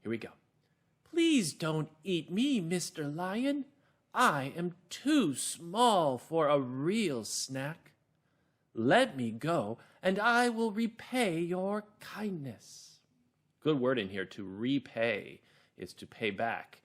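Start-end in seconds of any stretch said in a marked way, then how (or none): 1.0-13.0 high, slow, pleading little mouse; small mouse voice. Shown as a demonstration. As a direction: beg the lion in a small, high voice. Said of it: "I think maybe a small voice".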